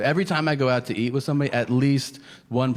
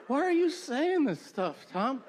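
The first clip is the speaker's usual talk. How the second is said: mock offended heckler; 12 semitones higher, slower than usual. Comic acting out offended audience member.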